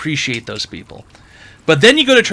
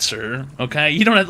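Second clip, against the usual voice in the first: ordinary delivery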